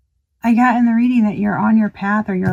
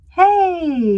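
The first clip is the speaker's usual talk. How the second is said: high, bright hey; mock greeting at door; 10 semitones higher, 3 dB louder than usual. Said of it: "and they're like".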